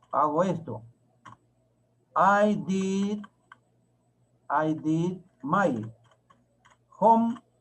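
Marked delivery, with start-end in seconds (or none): 2.1-7.5 word by word with long pauses; very slow, spaced-out teacher dictation. Shown as a demonstration. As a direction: dictate it slowly in small chunks. Teacher dictates sentence while writing it.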